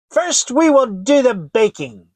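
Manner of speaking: put-on sweetly plotting old hag; witch voice. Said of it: "said the old woman".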